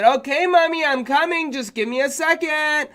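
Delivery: put-on boy calls back to his mother; young boy. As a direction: answer like a little kid. Child answering mom.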